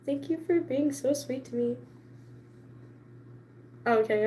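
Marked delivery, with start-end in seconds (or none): 0.0-1.8 much softer, grateful murmur; a hushed, touched thank-you. Retold or imitated. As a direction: thank her quietly and meekly. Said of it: "And I was just like".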